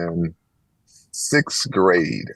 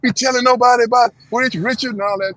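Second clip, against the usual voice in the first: high, louder imitated tirade; mock cursing; 13 semitones higher, 4 dB louder than usual. Mother rages at the real mother over the secret, high imitated tirade. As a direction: rant at her across the field. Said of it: "she was going crazy".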